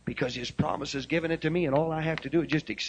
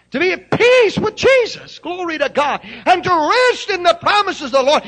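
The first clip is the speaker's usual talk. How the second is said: loud, high shouted praise; full-force preaching; 14 semitones higher, 11 dB louder than usual. Acted. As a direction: ring it out in praise. Preaching climax.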